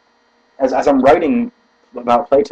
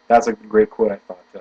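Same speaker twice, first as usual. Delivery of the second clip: ordinary talk, nothing marked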